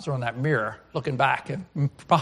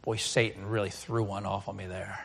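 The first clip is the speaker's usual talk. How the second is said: low, hushed, defeated sigh; soft, deflated aside in prayer; 6 semitones lower, 11 dB quieter than usual. Acted. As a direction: mutter it wearily up to heaven. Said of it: "we sigh, look to heaven and say".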